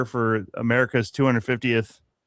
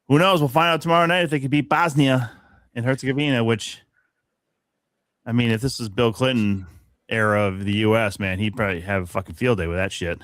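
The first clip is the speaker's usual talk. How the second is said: ordinary talk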